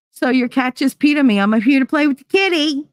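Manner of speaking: high, bright mock-innocent; playful pretend. Suggesting a prank on people upstairs, high and playful. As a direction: act sweetly weird to spook them. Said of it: "be like".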